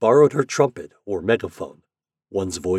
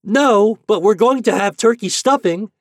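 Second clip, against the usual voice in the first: high, loud, proud reply; proud boy shouting; 14 semitones higher, 9 dB louder than usual. A narrator's reading. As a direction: call back proudly like a small boy. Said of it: "answered Ben with pride".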